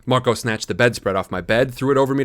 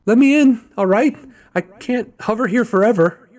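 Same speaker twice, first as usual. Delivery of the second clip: much higher and louder impatient bird; talking bird; 11 semitones higher, 6 dB louder than usual. A narrator's reading. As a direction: squawk to be let in. Bird speaking at window.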